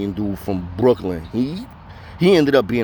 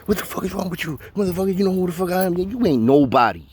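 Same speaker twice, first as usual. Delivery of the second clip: high, fast imitation of a furious inmate; angry tirade; 7 semitones higher than usual. Inmate berating embarrassed Dom, high and fast. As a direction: rattle off the insults. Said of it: "And he's like".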